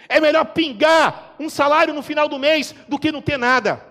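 rising from firm to a loud high shout; building preacher's shout. Retold or imitated. Retold advice, building to shout.

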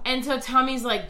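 normal talking voice

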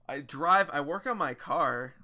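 words pushed out on a burp. Real, not acted. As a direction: burp through "I drive". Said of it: "it's my turn to burp now".

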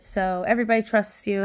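ordinary delivery

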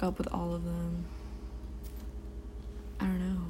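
talking while chewing popcorn; mouth full. Real, not acted. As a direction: speak around a mouthful of popcorn. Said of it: "Oh yeah, I'm eating popcorn right now".

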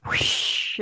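breathy whoosh of a scene cut; whoosh sound. Shown as a demonstration. Co-host mimics a film scene cut, whispered whoosh. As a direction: blow out a quick swish like a transition. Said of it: "And then you go".

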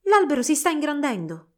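alto e forte, stupita; stupore. A narrator's reading. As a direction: Clara vede l'albero crescere, voce stupita e alta. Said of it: "pensò Clara".

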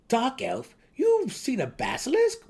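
wide-eyed gnome in disbelief; incredulous gnome. A narrator's reading. Young gnome asks the dark elf in disbelief, high and loud. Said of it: "asked incredulously".

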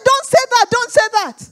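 high, loud repeated objection; acted insistent rebuke; urgent protest. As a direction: cut the caller off in alarm. Retold urgent rebuke on phone.

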